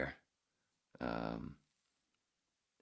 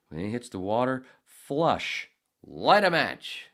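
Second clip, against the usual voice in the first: louder and higher, pounding out each step; insisting on matches after flushing, loud; emphatic instruction; 7 semitones higher, 10 dB louder, slower than usual. Real, not acted. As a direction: hammer home the toilet rules.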